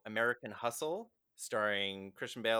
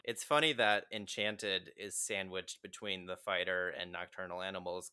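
ordinary talk, nothing marked

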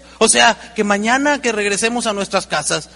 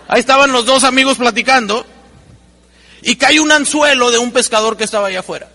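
ordinary talk

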